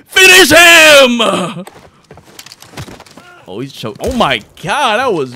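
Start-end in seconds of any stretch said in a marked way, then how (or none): 0.0-1.7 long, high, booming shout; bellowed fight command. Pretend. Announcer orders Killer Clown to finish, drawn-out shout. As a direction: stretch it out like a fighting game command.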